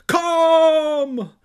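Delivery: very high, drawn-out scream; enormous wrathful bellow; wrathful calm. Acted. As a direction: scream the soothing word at full force. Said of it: "the wrath of calm".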